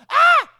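short, high, loud "Ah!" squeal; sudden squeal as if just tagged; quick squeal. Shown as a demonstration. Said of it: "do a little squeal".

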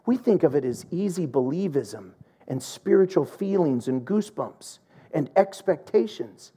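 ordinary delivery

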